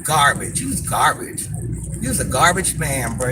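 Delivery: low, contemptuous put-down; disgusted. Real, not acted. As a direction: pronounce judgement in a low, disgusted voice. Scolding the commenter in disgust.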